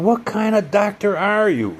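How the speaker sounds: high, loud indignant yell; challenging the doctor's credentials, yelling high; retold shout. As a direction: shout it at him in disbelief. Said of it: "I said".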